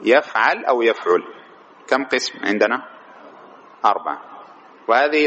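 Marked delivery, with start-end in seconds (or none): 0.0-0.6 يفعل said yaf'al, with an a after the ع; يفعل as "YAF-'al", short a in the last syllable; middle vowel a. Shown as a demonstration. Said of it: "مضارعه على وزن إيش؟". 0.8-1.2 يفعل said yaf'ul, with a u after the ع; يفعل as "YAF-'ul", short u in the last syllable; middle vowel u. Shown as a demonstration. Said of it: "مضارعه على وزن إيش؟".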